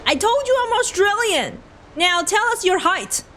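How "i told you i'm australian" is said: showing off a hammed-up Australian accent. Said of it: "Just listen to this accent".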